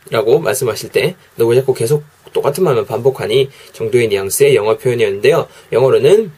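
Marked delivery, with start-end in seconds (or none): none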